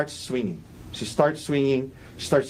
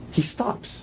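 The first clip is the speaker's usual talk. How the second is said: hushed, pointed repeat for the jury; whispered emphasis; 6 semitones higher, 4 dB quieter than usual. Real, not acted. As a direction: whisper it, higher, the point sinking in.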